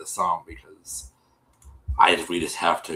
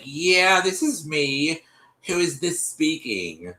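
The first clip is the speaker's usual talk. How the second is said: lightly voiced girl answering, higher and drawn out; imitating her on the phone, pitched up; retold phone answer; 7 semitones higher, slower than usual. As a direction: answer the call in a higher, slower voice.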